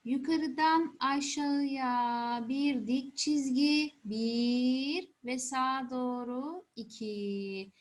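slow, drawn-out counting demo; slow stroke count. Shown as a demonstration. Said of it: "Bir daha yapalım mı?".

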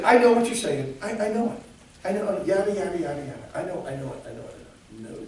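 mock brush-off, starting high and trailing away; dismissive mimicry. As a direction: imitate someone impatiently tuning out, fading off. Mimicking what not to say.

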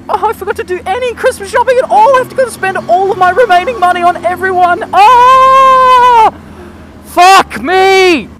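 high, loud, frantic put-on voice; panicked shopper voice. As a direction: squeal it out like a panicking last-minute shopper. Mocking frantic Christmas shoppers.

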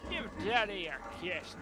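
hammed-up seductive drawl, slow; mock flirty. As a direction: flirt like a cheesy lover. Flirting with creepy picture.

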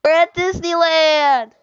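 loud, drawn-out announcement; loud slow shout. Acted. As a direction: shout it slowly for the app. Making [name] repeat the line.

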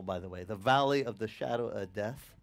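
ordinary talk, nothing marked

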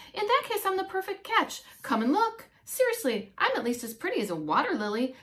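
high, bright-eyed vain frog voice, rushing the end; vain little frog. Acted. Frog Antoinette showing off her reflection, high and vain. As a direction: show off like a frog who knows she's pretty.

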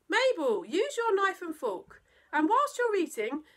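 high, loud telling-off; parent's exasperated reprimand; scolding parent. Acted. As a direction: scold a naughty child at the table.

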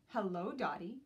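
ordinary delivery

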